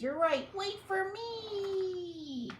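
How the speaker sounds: panicked mouse chasing the troops; squeaky mouse. Acted. Big Cheese follows troops.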